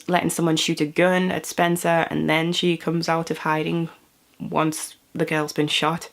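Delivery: usual speaking voice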